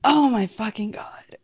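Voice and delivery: breathless whispered disbelief; stunned whisper. Real, not acted. Reacting to Marcus coming up next, a stunned whisper. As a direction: whisper it like you can't believe it.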